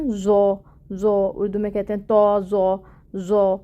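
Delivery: زو said with a buzzing Urdu z, as not to do; wrong Urdu z sound. Shown as a demonstration. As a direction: demo the wrong buzzy زو. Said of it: "اس طرح کی sound نہیں آئے گی".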